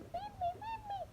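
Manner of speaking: very high, soft squeaky peep; hungry baby chick. Acted. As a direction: squeak it out like a tiny chick begging for food. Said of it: "its beak, like this".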